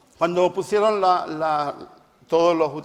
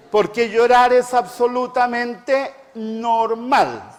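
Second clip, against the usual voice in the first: despacio y firme, palabra por palabra; énfasis lento; 5 semitones higher, 4 dB louder, slower than usual. Real, not acted. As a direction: subraya cada palabra con calma. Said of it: "recalca lentamente". (énfasis pausado)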